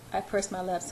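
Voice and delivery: plain reading voice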